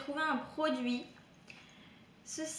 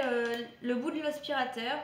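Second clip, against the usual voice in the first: normal talking voice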